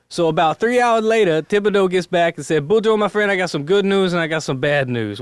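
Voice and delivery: Cajun accent. Shown as a demonstration. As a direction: play both buddies in a broad Cajun accent. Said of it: "do my best Cajun accent".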